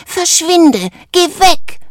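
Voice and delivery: high, loud panicked shout; frightened cry. A narrator's reading. Valentino scared of his changed friend, crying out. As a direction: cry out in alarm, voice way up. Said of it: "rief Valentino".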